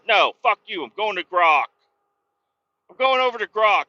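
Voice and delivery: hammed-up furious retort; mock angry outburst. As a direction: storm off yelling at the chatbot. Retelling telling ChatGPT off.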